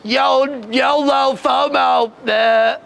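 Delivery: drawn-out bleating sheep voice on the words; mock sheep bleat. Student answers the rabbis, mocking sheep bleat. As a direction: bleat the slang like a mindless sheep. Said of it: "And you're like, "Meh".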